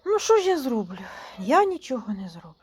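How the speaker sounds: normal talking voice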